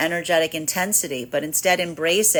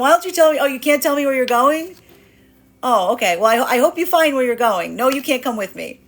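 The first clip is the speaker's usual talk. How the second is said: higher, louder teasing voice; playful feminine brush-off; 6 semitones higher, 6 dB louder than usual. Acted. Feminine energy brushing off a lost masculine, playful and higher. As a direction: toss it off brightly, dodging him. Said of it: "This is feminine energy going".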